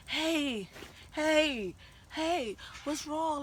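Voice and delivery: high, loud drawn-out hey; calling after someone. Retold or imitated. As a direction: imitate him calling out as he runs up. Boyfriend running after her, calling out.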